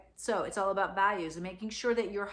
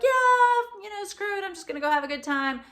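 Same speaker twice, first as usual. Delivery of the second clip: carefree mock splurger; 11 semitones higher, 6 dB louder than usual. Warning holiday spenders by mimicking their impulse, mock carefree. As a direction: mimic a reckless good-time attitude, pitched up and quick. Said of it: "be careful of this whole".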